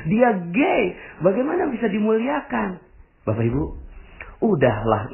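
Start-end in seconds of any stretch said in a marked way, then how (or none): 0.1-2.8 incredulous objection. Pretend. Imagined objecting listener.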